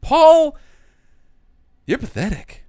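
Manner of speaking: high, loud call of the name, then a dismissive verdict; a fortune teller passing her verdict. Retold or imitated. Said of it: "she reads his palm and says".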